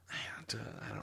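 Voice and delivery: breathy whisper. Real, not acted. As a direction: whisper it under your breath. Whispered, unvoiced.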